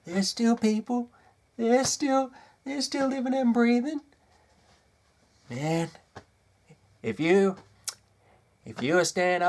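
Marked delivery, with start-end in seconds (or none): 0.1-4.0 much higher, whiny mock pleading; mock do-gooder. Ranter mocks the do-gooders who criticise him, high whiny voice. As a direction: mimic a bleeding-heart critic mockingly. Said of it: "you fucking do-gooders are like".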